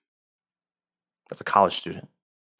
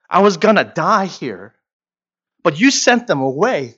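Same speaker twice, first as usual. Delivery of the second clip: high, loud, crying out to be saved; desperate pleading woman; 11 semitones higher, 9 dB louder than usual. Acted. A condemned woman cries out to her Lord, pleading high and loud. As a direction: beg him as your only hope, voice straining up. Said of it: "But she says".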